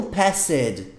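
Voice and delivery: "passed" in two syllables, pass-ed; wrong pronunciation. Shown as a demonstration. Teacher showing the learner's mistake, "passed" as pass-ed. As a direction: sound out the E in "passed" as a separate syllable. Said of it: "E não passed".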